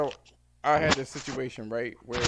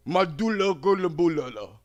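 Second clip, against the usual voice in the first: put-on African accent; 5 semitones higher, 7 dB louder than usual. Customer says his name.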